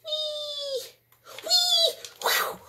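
very high, long delighted whee; gleeful squeal. Real, not acted. Flying stunt, gleeful.

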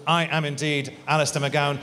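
ordinary talk

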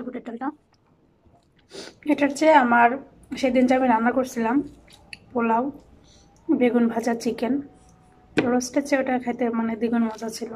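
talking between chews; slightly muffled chewing speech; mouth full, chewing. Real, not acted. Seen 2: Bite taken just before; lips pursed, jaw working while she talks.

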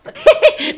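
high sudden mocking laugh; high incredulous laugh; burst of laughter. Real, not acted.

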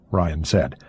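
ordinary delivery

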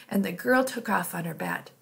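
ordinary delivery, nothing marked

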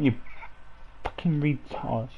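ordinary talk

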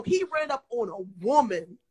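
ordinary talk, nothing marked

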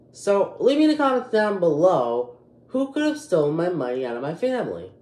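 normal talking voice

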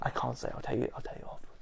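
very soft, near-whispered and quick; hushed confidential aside. Real, not acted. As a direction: keep it off the record, almost whispering. Holding back a name, aside.

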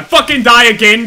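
loud warning, starting to boil over; exasperated shout. Real, not acted. Player who has died three times, exasperated shout. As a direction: raise your voice in exasperation. Rant about dying repeatedly.

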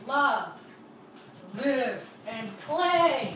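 announced name. Pretend. Reads channel name out grandly.

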